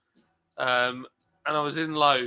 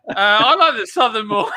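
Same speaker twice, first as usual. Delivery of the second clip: loud, high mock American lady; hammed-up imitation of an excited woman; imitated American woman; 7 semitones higher, 9 dB louder than usual.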